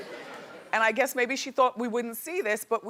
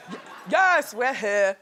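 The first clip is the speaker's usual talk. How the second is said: put-on a light English accent, laughing; mock English accent; 5 dB louder, slower than usual. Imitating the stylist's English accent while shopping. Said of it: "he's got an English accent".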